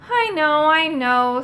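weary, sighed resignation; exasperated, sighing acceptance. A narrator's reading. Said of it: "said Moongobble with a sigh".

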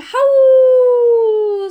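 storyteller howling the word like a wolf; howled word. A narrator's reading. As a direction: draw HOWL out into a long, loud, high wolf howl. Blood-curdling howl acted on the word.

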